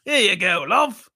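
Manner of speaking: playful Cockney impression; hammed-up British endearment; mock British accent. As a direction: lift the end like a cartoon Brit. Imitating British people, they were like.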